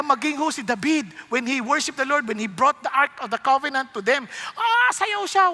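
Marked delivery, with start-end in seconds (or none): 4.6-5.5 high, drawn-out "ah" of abandon; lively retelling of joyful dancing; carried-away excitement. Retold or imitated. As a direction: burst out, pitch leaping up. Acting out David's joyful dancing.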